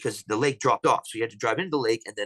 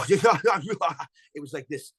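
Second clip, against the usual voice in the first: slow, higher, strange laughing voice; imitating laughing talk; 6 semitones higher, much slower than usual. Replicating the creatures' laughing talk for the host. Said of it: "if I try to replicate".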